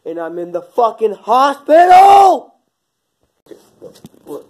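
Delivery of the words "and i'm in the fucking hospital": slow, loud furious yell; furious shout. Acted. Shot man yells at his friend over the phone. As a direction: blow up at him, spitting each word.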